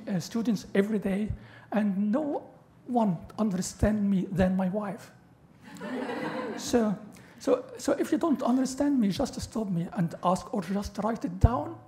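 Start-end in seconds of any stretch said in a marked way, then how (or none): 5.7-6.5 short breathy laugh at his own joke; airy laugh. Real, not acted. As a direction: laugh it off, unvoiced and high.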